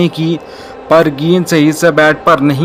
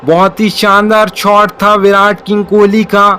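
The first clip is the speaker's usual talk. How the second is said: raised live call of the shot; excited cricket commentary; 4 semitones higher than usual. Real, not acted. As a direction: call the ball as it happens, pumped.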